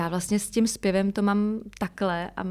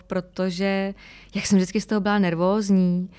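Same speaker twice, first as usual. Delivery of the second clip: normal talking voice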